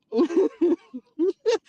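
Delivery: loud high cackle; real high-pitched laugh. Real, not acted. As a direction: burst out laughing. Laughs at own rant.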